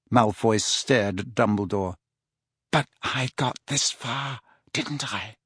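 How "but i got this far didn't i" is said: slow, quiet Draco. A narrator's reading. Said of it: "he said slowly".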